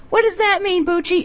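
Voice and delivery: put-on high, childish question; child's voice. Niece asking uncle what the word means, childlike. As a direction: ask like a curious little kid.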